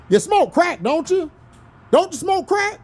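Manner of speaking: accusing, interrogating movie principal, lightly voiced; imitating a stern principal. Quoting Lean On Me, principal confronting a student, mock stern. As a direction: grill him like a hard-nosed principal. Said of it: "What did my boy say on Lean On Me?".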